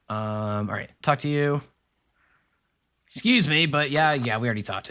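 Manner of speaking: ordinary delivery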